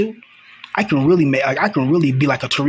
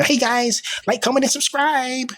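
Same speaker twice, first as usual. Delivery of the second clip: high, bubbly fake-YouTuber intro; mocking YouTuber voice; 10 semitones higher than usual. Mocking fake influencers who greet viewers, high and bubbly. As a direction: parody a cheesy influencer greeting. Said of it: "I'm not gonna come on here".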